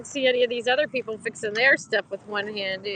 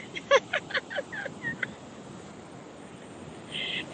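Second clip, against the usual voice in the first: breathless laugh; 4 dB quieter than usual. Real, not acted. Laughing in cold water.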